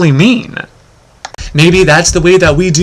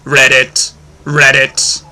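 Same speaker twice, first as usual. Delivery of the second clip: frog voice; 2 semitones lower, much slower than usual. Acted. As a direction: croak it like a frog, each read it alike. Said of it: "and the frog would go".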